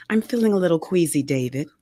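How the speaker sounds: ordinary delivery, nothing marked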